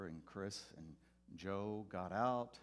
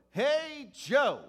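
much higher and louder, hammed-up preacher hello; corny preacher greeting; 19 dB louder than usual. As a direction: overdo the cheesy pastor call across the lot. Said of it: "like, just like a corny preacher does".

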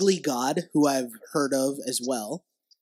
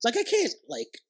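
incredulous, pitched high; exasperated disbelief; 12 semitones higher than usual. Real, not acted. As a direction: voice shooting way up in disbelief. Exasperated at rapper names.

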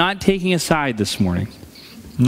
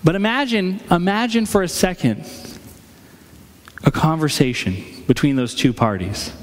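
ordinary talk

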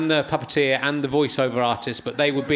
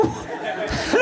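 much higher, breathless squeaked comedy Scottish hello; a laughing voiceless mock Scottish squeak; Ian McCaskill squeak. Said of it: "a comedy Scottish accent".

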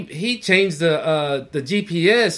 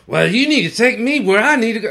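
louder and higher, insistent; demanding passenger; 5 semitones higher, 6 dB louder than usual. Retold or imitated. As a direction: demand it like an angry rider. Passenger demanding her destination.

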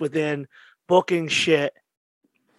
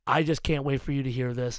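ordinary delivery

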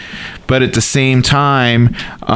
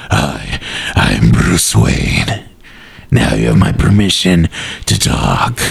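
very deep, slow guttural voice; dark, guttural superhero voice, dragging each phrase; Batman; 3 semitones lower than usual. Acted. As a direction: growl it out low and drawn out. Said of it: "Now do your Batman voice".

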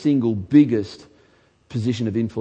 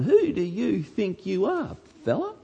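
defiant scoffer; 8 semitones higher than usual. Retold or imitated. Listener lightly voiced scoffing at the preacher. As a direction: mildly sneer back at the preacher. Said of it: "Maybe that's your attitude".

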